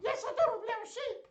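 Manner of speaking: very high, squeaky puppet voice; high-pitched toy sheep squeal; Little Sheep. Pretend. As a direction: pipe up as a tiny excitable sheep. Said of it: "in Little Sheep's voice".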